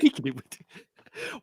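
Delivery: slow African accent on "Taking it with you". Shown as a demonstration. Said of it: "No, no, no".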